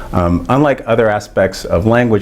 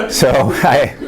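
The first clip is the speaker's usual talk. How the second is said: laughing while talking; 7 semitones higher than usual. Real, not acted. Laughing about moving to Nashville.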